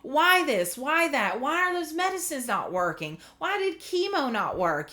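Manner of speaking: high-pitched, exasperated string of questions; angry questioning. Retold or imitated. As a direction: plead for answers in frustration. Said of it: "I get so angry because I'm like".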